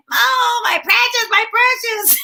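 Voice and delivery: mock Gollum voice, high and possessive; Gollum-like creature voice. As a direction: croon over the ring like a creature in love with it. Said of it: "that was when Lord of the Rings was really big".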